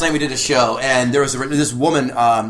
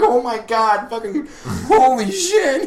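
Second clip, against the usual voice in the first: shocked; 7 semitones higher, slower than usual. Retold or imitated. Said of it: "me in the back, like".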